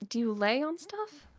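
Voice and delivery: put-on high, slow, unsure child's guess; very high, slow, puzzled child voice; puzzled kid. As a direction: sound out a guess like a confused boy. Kid guessing word meaning.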